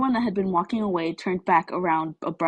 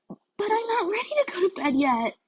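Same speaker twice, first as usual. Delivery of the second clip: put-on much higher, childish near-whine; whiny; 4 dB quieter than usual. A narrator's reading. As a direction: plead like a kid at bedtime. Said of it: "she almost whined".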